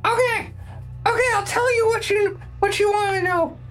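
high, fast, cowering goblin. Acted. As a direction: babble it out like a scared goblin. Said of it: "Great goblin voice".

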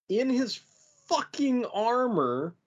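ordinary delivery

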